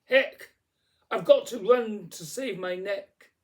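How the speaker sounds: high, loud, alarmed exclamation; urgent high-pitched outburst; shouting. A narrator's reading. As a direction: shout it in sudden panic. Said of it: "She shouted".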